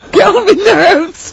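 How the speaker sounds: high, loud shriek through laughter; helpless laughter. Real, not acted. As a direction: squeal it slowly, cracking up. Gleefully repeats Carl's complaint.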